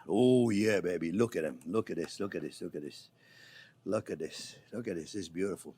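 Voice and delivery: low, hushed murmur to the food; soft low doting murmur; tender cooing. Real, not acted. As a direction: coo over them like babies. Cooing over freshly cooked eggs.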